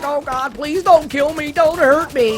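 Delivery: high-pitched, pretend-scared pleading; imitating a terrified captive; mock frightened hostage. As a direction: beg for your life in a hammed-up high voice. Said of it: "pretends to be one of the businessmen".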